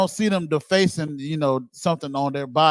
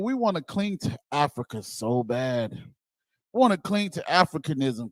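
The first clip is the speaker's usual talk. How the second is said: ordinary talk, nothing marked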